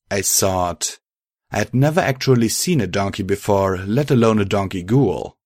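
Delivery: plain reading voice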